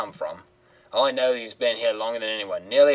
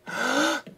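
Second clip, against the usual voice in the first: high hammed-up gasp; mock gasp. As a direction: fake a dramatic gasp. Mock suspense before opening drawer.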